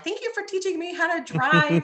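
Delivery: high, bright mock thank-you; mock grateful gushing. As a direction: gush your thanks like an eager kid. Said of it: "I'm like".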